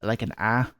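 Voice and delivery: ordinary talk, nothing marked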